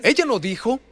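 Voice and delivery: ordinary delivery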